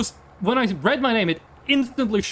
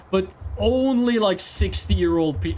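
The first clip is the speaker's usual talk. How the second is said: ordinary talk, nothing marked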